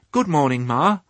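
high, polite morning greeting; cheery piglet. Acted. Piglet greeting the old ewe, high and cheery. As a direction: greet as an eager little pig.

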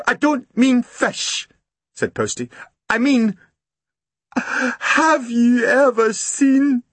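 high, breathless Scots voice, pouring out the story; dripping postman tells Grumble his boat sank, high and upset; shaken Scottish postman. Acted. Postman telling of his sunk boat, pitched high with alarm.

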